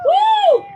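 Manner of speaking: high whooping cheer; excited woo. Real, not acted. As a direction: whoop at the crowd. Speaker greets cheering crowd.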